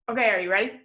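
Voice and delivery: normal talking voice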